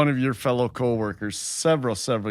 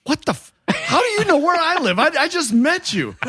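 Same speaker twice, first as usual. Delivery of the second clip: high, alarmed "what the"; shocked disbelief; 12 semitones higher than usual. Retold or imitated. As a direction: squeal out the shock, pitched way up. Shocked at the mailman knowing address.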